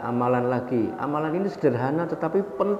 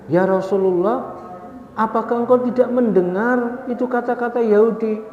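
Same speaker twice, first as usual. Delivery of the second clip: higher, louder, upset protest; indignant wife; 9 semitones higher, 3 dB louder than usual. Retold or imitated. Aisyah protests to the Prophet in a higher, louder, indignant voice. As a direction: protest as if you can't believe he let it pass. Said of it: "Kata Siti Aisyah".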